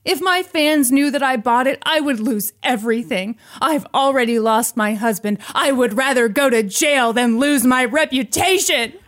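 loud, high, melodramatic outpouring; hammed-up confession. Brooke confessing she is a fraud, overwrought and loud. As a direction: wail it out like an overacted soap confession.

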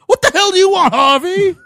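high, loud indignant mock shout; mayor's yell. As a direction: bellow it as the tough mayor. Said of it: "play the mayor and yell out".

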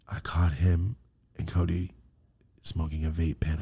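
low, soft, whispered bad news; grave, breathy confiding voice; hushed revelation. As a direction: break it gently, almost in a whisper. Retold mother's grave confession.

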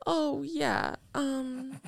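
high, small hesitant voice; mock a tearful, faltering reply; softly acted upset mumble; imitated crying girl. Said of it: "she goes like this".

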